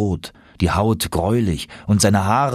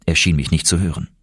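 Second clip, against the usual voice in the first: ordinary delivery, nothing marked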